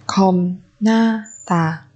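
very slow "Khom na tà"; syllable by syllable. Shown as a demonstration. Teacher modelling a word for learners, very slowly. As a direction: space out each syllable of "Khom na tà".

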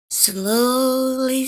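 plain reading voice

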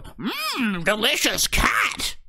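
high, slow, greedy fish-folk voice; hungry fish creature. Acted. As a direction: savor the word delicious like a monster.

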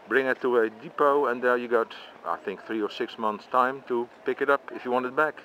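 ordinary talk, nothing marked

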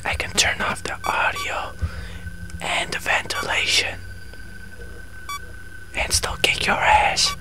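eerie high whisper, breathy and smug; taunting whisper. Pretend. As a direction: hiss a secret threat to the enemy.

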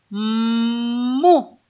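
"mu" with a long hummed m gliding into u; phonics-style stretched "mmmu"; joining two sounds. Shown as a demonstration. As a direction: hold the m of "mu" before the vowel. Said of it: "si juntamos los dos sonidos".

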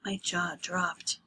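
ordinary delivery

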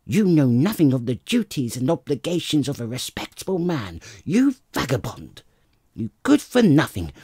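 loud, high furious tirade; furious wife. Acted. Wife driving her husband out with the nose, loud and furious. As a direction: hurl the insults at him.

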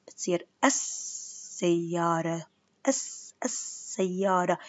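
السيارة slowly with no l, doubled s: assayyara; sun lam slowly. Shown as a demonstration. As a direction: lean on the double s. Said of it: "لما ما ألفظ ال بتكون لام شمسية".

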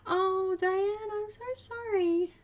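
high, tender condolence; real sympathy. Real, not acted. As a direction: comfort a grieving friend gently. Consoling Diane.

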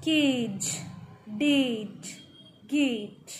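केज, डेट, गेट with a lengthened A; long vowel. Shown as a demonstration. Teacher showing the long A, vowel stretched in केज, डेट, गेट. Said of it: "लंबा पढ़ रहे हैं".

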